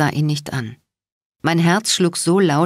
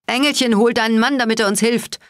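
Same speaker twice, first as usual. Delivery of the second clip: higher, raised voice calling out across the rotor noise; bellowing; 6 semitones higher than usual. A narrator's reading. Spade shouts to Cat over the rotors, bellowing. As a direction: shout for help over the helicopter. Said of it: "brüllte Spade".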